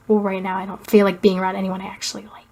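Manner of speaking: low, fast, dry put-down; low Clarke quote. Retold or imitated. Quoting Clarke's low, dry put-down from the show. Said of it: "she's like".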